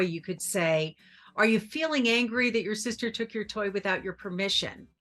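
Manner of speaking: ordinary talk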